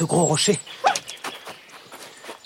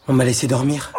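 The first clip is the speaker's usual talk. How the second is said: ordinary talk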